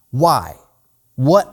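usual speaking voice

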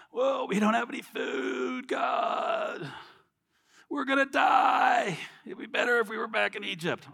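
high, complaining whine; whining Israelites. Retold or imitated. Israelites complaining to God in the desert, high whiny voice. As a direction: whine like a starving crowd. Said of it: "they're like".

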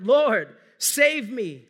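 loud, urgent cry for help; cried out. Acted. Preacher voicing Peter sinking in the wind, loud desperate cry. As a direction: call out like a man sinking in the waves. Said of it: "he cried out".

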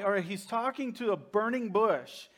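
usual speaking voice

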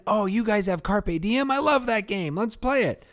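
high, gushing board game fan; excited fan. Pretend. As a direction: gush like a superfan. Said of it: "if someone suggested it for whatever reason".

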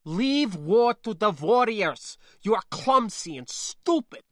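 put-on high, loud accented scolding; fuming. Niece scolding spacer to leave war alone, fuming. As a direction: fume at them like a tiny rhino about to charge. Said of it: "she snorted and fumed like a tiny rhino".

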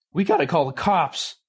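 high, loud alarmed cry. Acted. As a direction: call out in alarm. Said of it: "Ravi cried".

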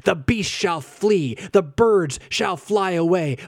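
slow, booming proclamation, pitched high; loud voice. A narrator's reading. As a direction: proclaim it high and loud, drawing out each command. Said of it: "speaking with a loud voice".

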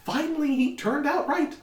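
high-pitched, fussing character voice; high, flustered in-character chatter; Esmeralda. Acted. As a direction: hurry along in a high, excited voice. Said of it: "Esmeralda's kind of like".

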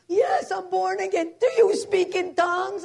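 loud, high, imitated Spanish-accented excitement. Maria answering her roommate, excited Spanish accent. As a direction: exclaim it in a mock Spanish accent. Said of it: "She was Spanish".

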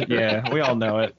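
ordinary talk, nothing marked

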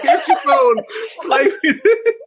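very high, loud, through laughter; squeaky-high, giggling delivery; laughing mock handover. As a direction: crack up while acting out the line. Joking acted line amid laughter.

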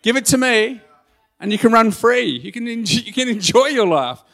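high, loud, insistent invitation; God. Retold or imitated. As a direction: urge it like a father eager to help. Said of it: "God's saying".

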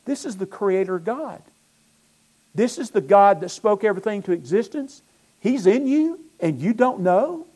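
high, loud incredulous preaching; fiery preaching. Acted. Pastor challenging doubting believers, fiery sermon. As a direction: demand the listeners wake up.

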